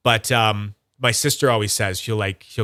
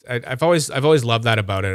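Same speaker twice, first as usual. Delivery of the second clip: usual speaking voice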